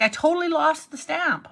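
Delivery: high, loud mock panic about the lost stamp. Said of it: "I'm like".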